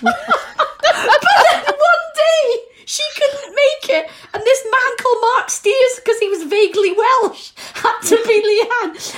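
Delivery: very high, squeaky laughing speech. Real, not acted. Telling of a stand-in for Leanne, laughing. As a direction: struggle to speak through the giggles. Breaking up over a funny memory.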